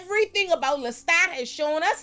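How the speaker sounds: high-pitched, insistent, worked-up rant; heated rant. Real, not acted. Viewer ranting that Lestat can't be trusted, heated. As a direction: argue it hard, voice pushed way up.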